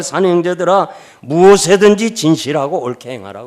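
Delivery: 높고 크게 외치는 설교 톤; 성도들에게 말씀을 힘껏 선포하듯. Acted. 설교 절정에서 성경 인용. (힘 있게 외치는 설교)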